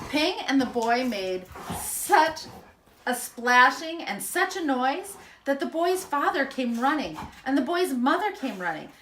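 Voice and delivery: plain reading voice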